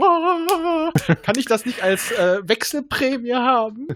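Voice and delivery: high, whiny plea; pleading whine. Retold or imitated. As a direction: beg like a child. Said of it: "ich dachte mir so".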